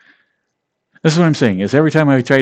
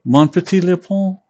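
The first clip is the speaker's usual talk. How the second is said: flirty French line; 8 semitones higher, 4 dB louder than usual. Pretend. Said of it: "Be like".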